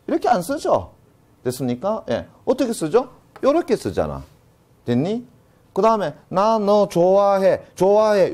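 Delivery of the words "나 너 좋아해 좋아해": 좋아해 read slowly and a little louder; 좋아해 said slowly and deliberately; slow spelled-out example. Shown as a demonstration. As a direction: sound 좋아해 out slowly, as it is pronounced. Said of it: "요렇게 씁니까?".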